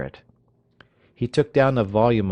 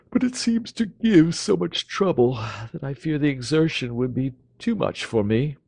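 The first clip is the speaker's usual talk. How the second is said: languid dandy; 6 semitones higher, 3 dB louder than usual. Acted. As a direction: sigh it out as too much effort.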